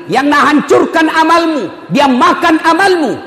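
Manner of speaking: ordinary talk, nothing marked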